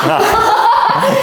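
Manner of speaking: high burst of laughter; hearty laugh. Real, not acted. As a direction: laugh out loud. Doctor laughs loudly.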